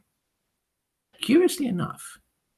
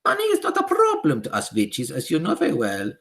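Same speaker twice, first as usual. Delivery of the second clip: high, loud witch voice with a foreign lilt; high imperious witch decree; Grand High Witch; 11 semitones higher, 8 dB louder than usual. Acted. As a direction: command the witches like a tyrant queen.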